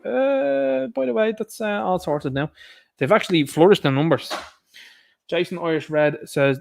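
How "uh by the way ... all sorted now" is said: high mock-casual official voice; sing-song dismissive officialdom; mock the officials, high and breezy. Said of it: "they're like".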